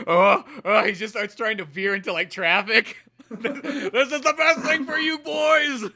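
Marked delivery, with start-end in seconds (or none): 3.3-5.8 high, slow laughing maniac dad; crazed dad. Acted. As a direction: rave like an unhinged father. Crazed dad swerving into traffic.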